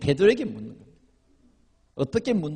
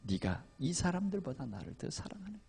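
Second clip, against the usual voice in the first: softly voiced question from the Lord; hushed, measured quoted question; 11 dB quieter than usual. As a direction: ask quietly, lightly playing a searching master. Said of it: "주님이 말씀합니다".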